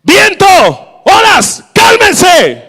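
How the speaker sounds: very high, loud, word-by-word orders; acted full-force rebuke of the elements; shouted command. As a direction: yell at the storm to settle.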